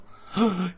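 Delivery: sharp high gasp of delight. Real, not acted. Streamer gasping in delight at the word mum. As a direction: gasp in surprised glee.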